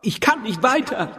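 put-on high, panicky girl's voice; panicked cry. A narrator's reading. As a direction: Doro cries out in fear at the hole. Doro frightened at the hole. (panisch, mit hoher Stimme gerufen)

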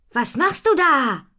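loud, high, stretched challenge; sharp-tempered storybook reproach, loud and slow; stern demand. A narrator's reading. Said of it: "fragt Ponifee streng".

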